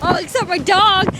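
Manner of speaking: ordinary delivery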